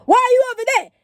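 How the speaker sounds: high, loud, indignant shout; imitated high-pitched holler; driver's mock yell. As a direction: act out an angry driver yelling across. Driver yelling in retold story.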